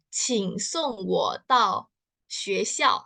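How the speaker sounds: "Qing song wo dao xue xiao." said slowly, word by word; slow Mandarin. Shown as a demonstration. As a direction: model the phrase slowly for students. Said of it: "ngomongnya gimana?". (pelafalan Mandarin pelan)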